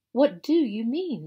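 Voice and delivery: high, loud, out of breath from climbing; panting. A narrator's reading. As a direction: gasp it out while scrambling uphill. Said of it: "panted Peter".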